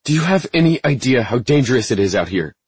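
loud, high scolding shout; angry shout. Acted. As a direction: demand it in anger. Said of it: "Rage vibrated every bone".

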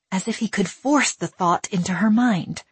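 usual speaking voice

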